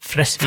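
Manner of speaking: Fresvik with short E and long S; dobbel S. Shown as a demonstration. Cohost demonstrating how it is pronounced, short E in Fresvik. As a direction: say Fresvik as Fressvik. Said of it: "dobbelt konsonantlyd av S-en".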